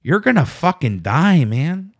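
blunt verdict in a put-on Mexican accent; mock Mexican accent warning; Mexican accent impression. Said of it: "stereotypical Mexican accent".

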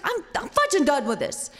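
retold loud huff; fed-up New York cop. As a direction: imitate an angry cop giving up. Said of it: "a New York tater hater".

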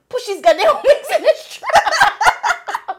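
very high, loud, slow, breaking into laughter; laughing mock cop. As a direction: stretch the line out while laughing hard. Officer exasperated, laughing retold.

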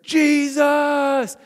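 high, loud shout; crowd's rallying shout; shouted answer. Retold or imitated. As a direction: yell the name back in unison. Crowd answers call-and-response.

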